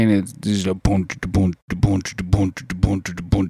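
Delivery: low thumping boom da; bass beat imitation. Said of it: "just constant bass".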